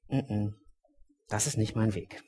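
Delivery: a soft, steady voice declining; calm refusal. Retold or imitated. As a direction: turn it down quietly and kindly. Jesus calmly refuses violence.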